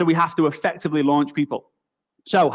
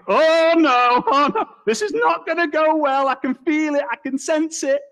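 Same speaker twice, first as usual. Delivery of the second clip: much higher, panicky inner voice; panicked inner voice; 10 semitones higher than usual. Retold or imitated. Young [name] panicking as the Russian guy walks in. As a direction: squeal your dread to yourself.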